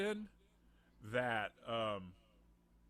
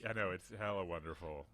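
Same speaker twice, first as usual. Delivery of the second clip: ordinary talk, nothing marked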